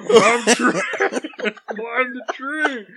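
high, slow, laughing giant voice; giant's voice. Pretend. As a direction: drawl it out like a dopey giant. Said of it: "a ten foot tall Scram just".

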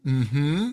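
rising hum of agreement; agreeing mm-hmm. Shown as a demonstration. Coach showing listeners an agreeing mm-hmm with a rising hum. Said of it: "go like you're agreeing with something and say".

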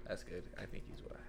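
whispered under the breath; muttered. Real, not acted. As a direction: mutter it to yourself. Muttered repeat of opinion.

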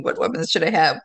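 ordinary delivery

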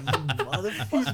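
put-on high-pitched indignant kid; mock outrage. Kid curses his teasing cousins, mock outrage. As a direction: curse them out, half laughing. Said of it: "And I was like".

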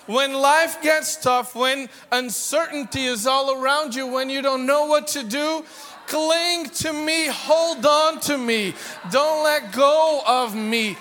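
loud, high, slowed plea of God; impassioned preaching. Acted. Preacher voicing God's plea.

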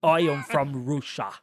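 slow, heavy mock Russian accent; bad Russian accent. Podcaster mocking the movie's Russian girl: slow bad Russian accent. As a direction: drag it out like a cartoon Russian. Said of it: "That's how she sounds".

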